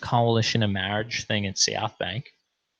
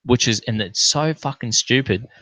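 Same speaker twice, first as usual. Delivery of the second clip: ordinary talk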